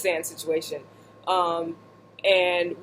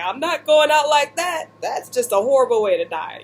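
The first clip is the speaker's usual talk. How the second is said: higher, louder mock defiance; defiant resolve; 5 semitones higher, 4 dB louder than usual. Stuck in the bog, she imitates her defiant self. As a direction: play up the stubborn refusal to die.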